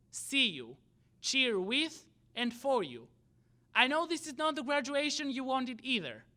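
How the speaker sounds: ordinary talk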